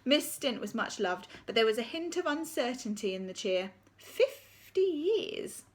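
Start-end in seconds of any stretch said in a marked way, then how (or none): 4.0-5.6 incredulous. A narrator's reading. Narrator's incredulous aside.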